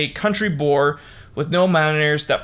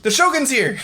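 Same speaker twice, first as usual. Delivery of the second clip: mock frantic shouting. Guards holding Asano back, high frantic mock shouts. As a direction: shout at him in a panic to stop. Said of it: "He's like".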